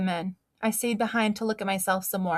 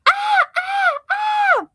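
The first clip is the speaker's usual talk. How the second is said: very high, loud shrieking ah; scariest scream; 8 dB louder, slower than usual. Acted. Girl scaring grandma, loud high clown shriek. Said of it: "I did my scariest scream".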